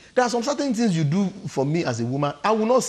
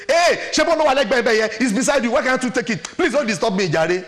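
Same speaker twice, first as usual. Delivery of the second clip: loud, high, exasperated complaint; acted-out annoyed partner, voice raised; irritated retort; 7 semitones higher, 7 dB louder than usual. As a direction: snap back loudly as if pestered.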